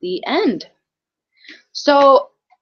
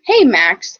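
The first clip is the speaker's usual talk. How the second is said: ordinary delivery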